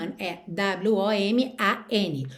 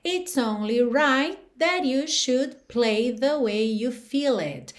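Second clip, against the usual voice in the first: slow demo; slower than usual. Shown as a demonstration. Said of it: "Mas aqui ela fez that you".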